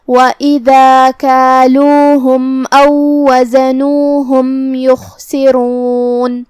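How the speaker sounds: slow, measured tajweed chant; Quran recitation. Shown as a demonstration. Teacher recites the verse for students, slow tajweed chant. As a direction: recite with each word drawn out. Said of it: "teacher recites the verse again".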